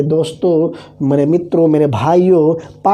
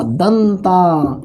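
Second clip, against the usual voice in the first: long drawn-out word; much slower than usual. Shown as a demonstration. Teacher stretches the key word.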